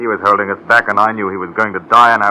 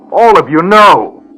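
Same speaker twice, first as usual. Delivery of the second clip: loud, high, drawn-out appeal; desperate outcry; 8 semitones higher, 7 dB louder, much slower than usual. Acted. Dying Oates appeals to all his companions, desperate outcry. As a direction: cry it out slowly, high and loud.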